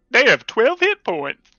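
high, slow announcer voice; mock robotic readout. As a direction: read it like a game screen. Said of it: "and it was like".

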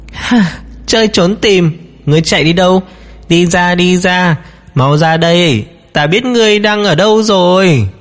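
breathy purring seductress taunting prey; crazed female ghost. A narrator's reading. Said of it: "Giọng nữ quỷ điên điên khùng khùng".

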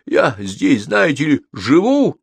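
high, indignant, lightly croaking protest; hoarse croak. A narrator's reading. Wizard reminds Sophie it is his home, hoarse. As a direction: protest with rising strain. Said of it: "прохрипел он".

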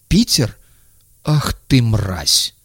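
shocked then furious; outraged. Acted. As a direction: spit out the insult.

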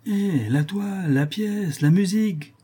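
high, drawn-out gallery call; theatre heckler's cry. A narrator's reading. Tortillard heckles from the stairs for the show to start, high drawn-out cry. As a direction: call it out slowly, high-pitched, like an impatient audience. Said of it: "il poussa ce cri familier aux habitués du paradis".

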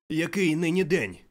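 high loud call down from the window. Acted. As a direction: hail a boy below. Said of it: "гукнув Скрудж до ... хлопчика". (Скрудж гукає хлопчикові з вікна, голосно)

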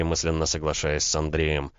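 usual speaking voice

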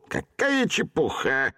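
медленно, с презрением; презрительно хмыкнуть; contemptuous scoff. A narrator's reading. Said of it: "хмыкнул презрительно". (презрительно)

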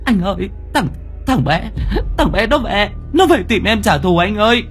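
high-pitched frightened woman, stammering; trembling fear. A narrator's reading. Girlfriend phones her boyfriend about a vengeful ghost child, trembling. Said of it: "Giọng của My run rẩy".

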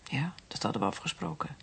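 zacht, toonloos gefluisterd; fluisterend verdriet. Acted. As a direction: fluister het, verdoofd van verdriet. Grieving daughter answers softly. (dochter fluistert verslagen na de dood van haar vader)

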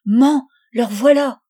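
loud, high, drawn-out warning call; frightened child's shout, slow and high; alarmed cry. Acted. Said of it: "la mine effarée et cria".

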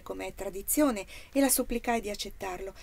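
plain reading voice

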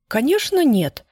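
quick blurt, first word jumping high. A narrator's reading. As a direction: blurt it out sharply. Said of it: "выпалила Изольда".

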